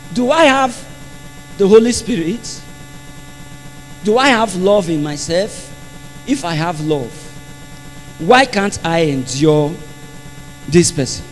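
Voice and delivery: low, soft and halting; hushed self-questioning. Retold or imitated. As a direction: question yourself quietly, pausing between phrases. Soul-searching question put to himself.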